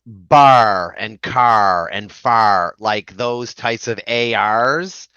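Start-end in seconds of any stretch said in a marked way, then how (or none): 0.0-2.8 Islander accent, slow and drawn-out on "bar", "car" and "far". Shown as a demonstration. Guest showing where their accent gets noticed, slow Islander accent. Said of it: "you notice it in, like".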